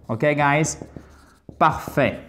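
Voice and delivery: ordinary talk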